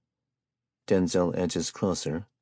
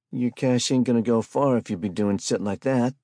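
a hint of a pushy hustler, higher; cocky street hustler; 6 semitones higher, 5 dB louder than usual. A narrator's reading. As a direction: lean on him with swagger. Spike voiced by the narrator.

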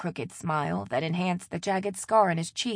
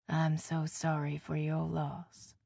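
mournful condolence; 2 semitones lower, 6 dB quieter, slower than usual. Acted.

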